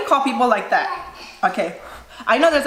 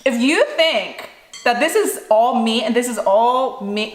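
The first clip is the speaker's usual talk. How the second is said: ordinary delivery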